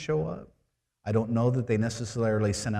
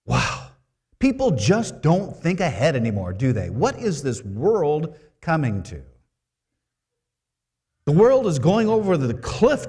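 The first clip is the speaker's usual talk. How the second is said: loud, high, exasperated mock complaint; hammed-up critical mom, louder and higher; mock critical Mary; 4 semitones higher, 7 dB louder than usual. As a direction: scold the couple with theatrical dismay. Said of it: "She didn't say".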